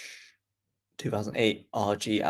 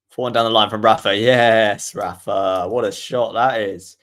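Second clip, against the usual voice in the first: fast, louder burst on the winner; excited commentary; 8 dB louder than usual. Acted. Commentator cheering Rafa's winner, excited and loud. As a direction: erupt as the winner lands. Winning shot in the rally.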